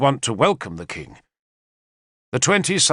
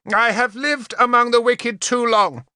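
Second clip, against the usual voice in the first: lofty, high proclamation; grand announcement; 10 semitones higher than usual. A narrator's reading. Queen announcing grandly to the court, lofty. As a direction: declare it very grandly. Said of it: "she announced very grandly".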